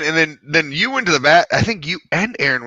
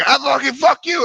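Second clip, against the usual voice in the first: high, sputtering mock anger; retold yelling; 10 semitones higher than usual. Angry boater sputtering at the driver, high mock yell. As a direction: imitate a man too mad to finish his insult. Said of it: "yelling at me".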